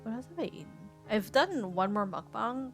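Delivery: usual speaking voice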